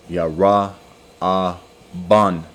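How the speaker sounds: Ra'aban drawn out very slowly as rah-ah-bahn; Hebrew pronunciation. Shown as a demonstration. Teacher sounding out the Hebrew name Ra'aban slowly for the class. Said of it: "I already, um, pronounced it in the Hebrew".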